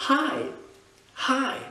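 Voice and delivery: high-pitched English-accented "hi", repeated. Shown as a demonstration. English teacher demonstrating repetition, high English accent. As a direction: drill "hi" high, in a native English accent, with pauses. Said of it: "après, il va falloir le répéter".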